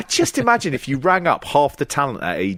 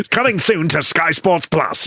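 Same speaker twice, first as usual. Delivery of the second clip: upbeat hammed-up radio trailer; mock Capital Radio announcer, half laughing; radio promo voice; 5 semitones higher than usual. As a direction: sell it like a cheesy radio link. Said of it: "I'm gonna do one of those Capital Radio links".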